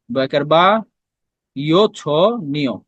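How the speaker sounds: বয়কার বা, ইয় ছ, নিয় read piece by piece with pauses; sounds spelled out. Shown as a demonstration. Teacher breaks বাঞ্ছনীয় into spoken parts for students. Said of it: "ইয় যোগ ছ উচ্চারণ করতেছো".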